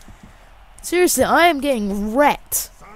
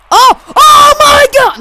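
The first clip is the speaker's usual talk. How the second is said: high, drawn-out scream at a goal; explosive high gamer shriek; 16 dB louder, slower than usual. Real, not acted. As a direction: scream it at full volume in shock. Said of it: "I will not scream".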